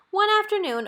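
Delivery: ordinary delivery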